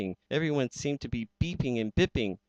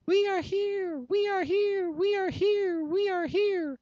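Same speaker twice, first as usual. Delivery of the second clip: very high, far-off squeaky chorus; many tiny voices calling in fear; tiny Whos crying out. Acted. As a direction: cry out from a speck, desperate to be heard. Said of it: "They cried out in fear".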